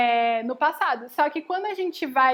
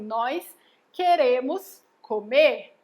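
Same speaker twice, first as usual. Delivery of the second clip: slow "Nós", pause, then a louder "queremos"; corrected verb stressed; 4 dB louder, much slower than usual. Shown as a demonstration. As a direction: set "queremos" apart and punch it as the correction. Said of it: "a gente fala assim".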